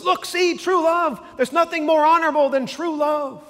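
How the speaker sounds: high, loud triumphant cry; excited shout. Retold or imitated. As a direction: cheer it out like you just won. Inigo excitedly cheers true love.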